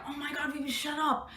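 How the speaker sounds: scolding the sister in a soft hush. Retold or imitated. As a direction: keep it down so nobody hears. Hushing her sister so no noise.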